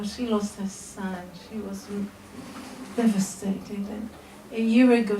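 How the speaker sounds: ordinary talk, nothing marked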